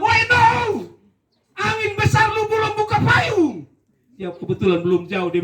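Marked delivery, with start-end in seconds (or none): 0.0-3.7 yelling. Retold or imitated. Commander yells a warning to the falling recruit. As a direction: shout across the wind.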